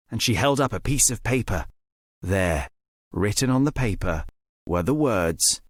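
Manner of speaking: usual speaking voice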